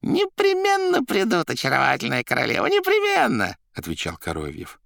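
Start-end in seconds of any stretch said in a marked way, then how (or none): 0.0-3.5 Koroviev's assurance. A narrator's reading. Koroviev assuring his queen, high and emphatic.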